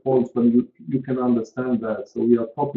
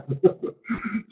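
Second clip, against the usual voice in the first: high light laugh; quick amused laugh; real laugh; 9 semitones higher, 5 dB quieter than usual. Real, not acted. Laughing at his own remark.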